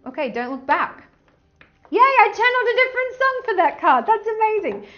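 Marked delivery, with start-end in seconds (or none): 1.9-4.9 high, loud, quick burst of glee; delighted cheer. Real, not acted. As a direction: cheer your own discovery. Delighted at channelling a song.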